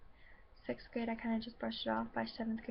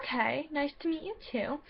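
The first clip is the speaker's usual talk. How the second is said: bright, higher, falsely cheerful "nice to meet you too"; airy mock-polite reply; 4 semitones higher than usual. Walking away from the bully, lightly mock-polite. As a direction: brush her off with fake politeness. Sarcastic reply as she leaves.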